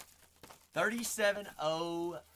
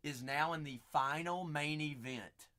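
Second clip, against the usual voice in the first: ordinary delivery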